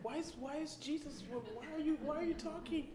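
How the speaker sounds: high, startled disbelief; mock-stunned disciples gasping at Jesus; shocked disciples. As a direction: squeak out the shocked question. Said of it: "Notice the reaction".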